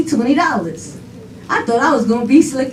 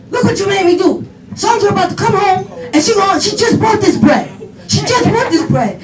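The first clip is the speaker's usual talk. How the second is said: high-pitched mock scolding; hammed-up parental exasperation; exasperated dad; 8 semitones higher than usual. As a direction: imitate a flustered father laying blame. Father blames child over bread.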